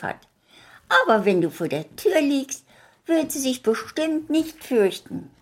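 put-on high, bright child voice throughout; little girl talking. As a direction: chatter kindly as the little girl.

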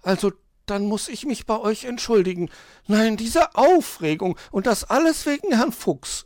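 high, agitated magpie; blurted out, flustered. A narrator's reading. Magpie apologises to children for her fuss, flustered and high. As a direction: burst out with the apology and grievance. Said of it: "stieß sie hervor".

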